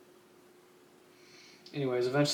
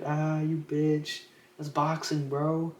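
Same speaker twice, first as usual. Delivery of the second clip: slow, higher, louder mocking jeer; drawn-out jeer; 4 semitones higher, 3 dB louder, much slower than usual. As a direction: stretch it out to mock him. Crowd jeering the complainer.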